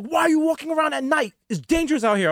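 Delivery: high-pitched, alarmed auntie scolding. Retold or imitated. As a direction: imitate a worried aunt telling off her nephew. Aunt scolding him for walking at night.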